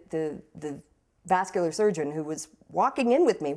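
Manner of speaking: ordinary talk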